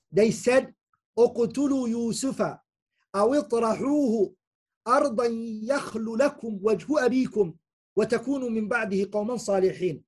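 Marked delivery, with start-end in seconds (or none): 1.2-10.0 Quran recitation. Acted.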